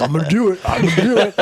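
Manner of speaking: high, loud, giddy repeat through laughter; laughing drunk insistence. Retold or imitated. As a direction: play the drunk friend insisting. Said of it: "He's like".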